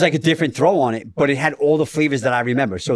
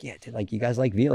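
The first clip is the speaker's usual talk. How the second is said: hushed near-whisper; 2 semitones lower, 7 dB quieter than usual. Retold or imitated. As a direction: imitate asking a table furtively, voice dropped. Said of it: "like, whisper, like".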